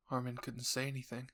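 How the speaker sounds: ordinary delivery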